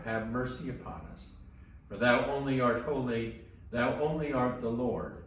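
usual speaking voice

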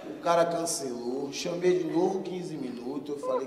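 ordinary delivery

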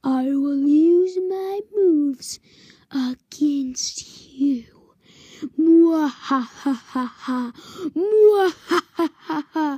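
slow loud evil laugh and threat; slow theatrical villain menace. Acted. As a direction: ham up a cackling villain.

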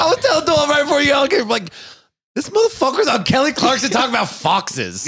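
cracking up while retelling the joke; excited laughing retelling. Retold or imitated. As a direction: blurt it out through laughter. Laughing retelling, high far voice.

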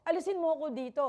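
imagined desperate prayer; acted plea. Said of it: "sasabihin ko siguro sa Pangi".

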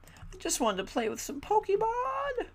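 put-on very high childish whine; whiny squeak. Gamer whining to viewers about Pokemon, very high.

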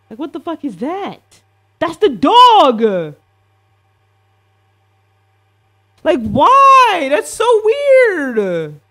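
high, loud, drawn-out shriek of alarm; freaked-out yell. Real, not acted. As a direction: yell it out, genuinely creeped out by the dog. Spooked by the dog image.

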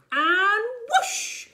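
high, loud swooping whoosh; thrilled takeoff call; excited whoosh. Acted. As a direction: launch the broom with a big whoosh. Broom takes off.

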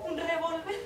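rising, disbelieving echo; incredulous question. Acted. Woman on the phone echoing her lover's word in disbelief. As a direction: repeat it back as if you can't believe it.

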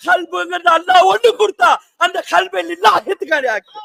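ordinary talk, nothing marked

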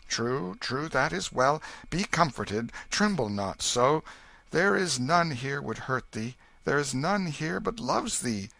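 put-on calm the frightened child; king reassuring his son; comforting king. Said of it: "patted it tenderly".

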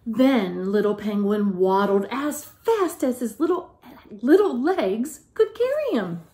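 lively, sing-song picture-book reading; reading to children. A narrator's reading. Teacher reading a picture book aloud to the class, lively and sing-song. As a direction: tell it like a bedtime story to little ones.

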